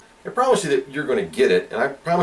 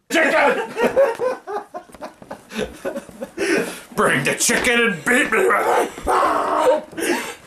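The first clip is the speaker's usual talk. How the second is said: playful shout; 11 semitones higher, slower than usual. Acted. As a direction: yell it half laughing.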